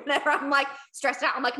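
ordinary talk

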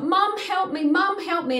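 put-on a frightened child calling out; pleading son. Son in bed crying out to his mum, high plea. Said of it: "he was like".

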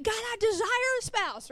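high, pleading put-on voice; mock prayer. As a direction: whine it up to God like a wish list. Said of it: "be like".

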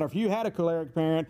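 ordinary talk, nothing marked

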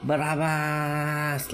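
slow chanted crowd cry; mock crowd shout. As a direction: call the name slowly like a crowd. Crowd shouting Barabbas's name.

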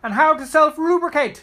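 loud high jokey shout. Real, not acted. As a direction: yell the joke out. Joking shouted addition.

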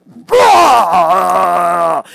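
long loud death-metal roar; mock angry roar. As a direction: roar like spewing angry juice all over them. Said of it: "I just vomit angry juice all over them".

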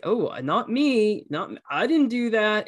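put-on a child pleading innocence; defensive kid. Child denying blame.

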